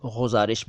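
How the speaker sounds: गुजारिश with a throaty ġ at the start; wrong nukta sound. Shown as a demonstration. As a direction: mispronounce गुजारिश as ghuzarish, G scraped in the throat. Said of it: "ग के नीचे नुक्ता लगा देते हैं".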